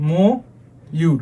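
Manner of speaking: ময়ূর slowly split as "mo... yur"; broken into syllables. Shown as a demonstration. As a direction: say ময়ূর in two parts, long "mo", pause, "yur". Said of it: "এভাবে উচ্চারণ করবো আমরা".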